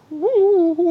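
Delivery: high swooping ghost hoot; ghost woo. Pretend. Ghosts flying out of the Ark hoot high.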